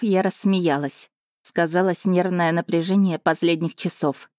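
ordinary delivery, nothing marked

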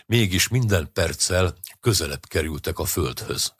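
plain reading voice

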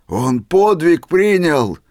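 loud, slow, high exclaim; delighted shout. A narrator's reading. Old man shouting with joy at Misha's feat. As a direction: proclaim it in awe, loud and drawn out. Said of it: "крикнул Семён Устиныч".

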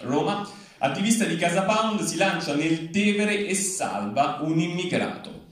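ordinary talk

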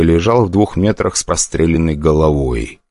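usual speaking voice

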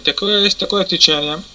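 ordinary talk

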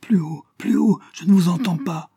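beaucoup plus aigu et plus fort, paniqué; cri affolé. A narrator's reading. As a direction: crie affolée au téléphone. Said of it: "criait affolée". (Janine, affolée, crie qu'elle n'entend pas)

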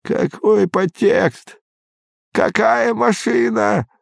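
put-on a bewildered frail old man. A narrator's reading.

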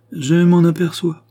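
high, loud ironic retort; ironic interjection. A narrator's reading. Countess interrupting the cardinal's vow of love, ironically. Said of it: "interrompit-elle ironiquement".

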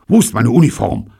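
high, loud startled question; agitated. A narrator's reading. Captured soldier wakes without uniform, agitated. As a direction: demand it in a flustered panic. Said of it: "fragte er aufgeregt".

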